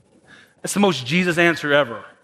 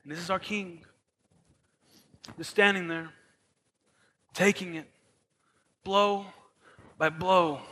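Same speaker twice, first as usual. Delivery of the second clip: hushed solemn telling; 9 dB quieter than usual. A narrator's reading. Solemn hush over the beating.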